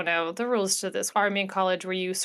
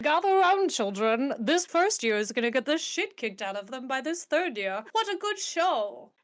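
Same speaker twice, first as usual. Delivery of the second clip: high, showy mock teacher calling the class over; hammed-up professor announcing a fight, much higher; gleeful professor; 6 semitones higher than usual. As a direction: play a delighted ringmaster teacher relishing a beating.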